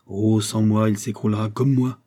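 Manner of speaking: usual speaking voice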